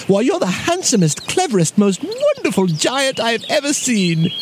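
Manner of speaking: high, flattering bear voice; lavish praise on a giant; high-pitched, fawning storybook bear; gushing little bear. A narrator's reading.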